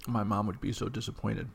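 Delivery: usual speaking voice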